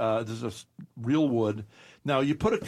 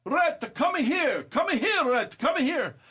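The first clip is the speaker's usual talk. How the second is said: yelling across the room; 13 semitones higher, much slower than usual. Retold or imitated. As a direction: call her name out across the room. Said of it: "She yells across the room".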